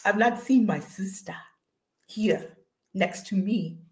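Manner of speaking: lightly put-on African accent; playful African accent, slow and measured at the end. Host missing her sister, in a playful African accent. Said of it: "get in my African vo-, voice".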